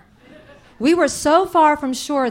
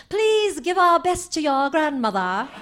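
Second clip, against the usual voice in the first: put-on higher, half Hepburn half Queen of England mid-Atlantic accent; posh Kennedy voice; 6 semitones higher than usual. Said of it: "Kennedy-esque way of speaking".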